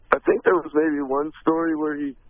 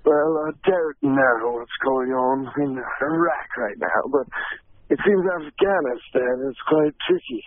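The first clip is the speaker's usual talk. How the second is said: slow, measured British detective; Sherlock Holmes; 2 semitones lower, slower than usual. Acted. Actor interviewed in character about world news, British detective voice. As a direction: answer slowly in a put-on British accent. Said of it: "let me interview Sherlock Holmes".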